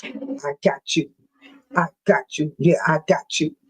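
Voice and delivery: very low mock-menacing voice; William Moore. Man walking around warning people off, very low mocking impression. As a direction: drop way down, imitating a man on patrol. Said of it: "Then William Moore, he walking around".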